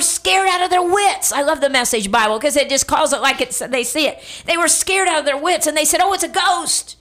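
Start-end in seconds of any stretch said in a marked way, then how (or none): 6.0-7.0 mock terror. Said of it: "they cried out in terror".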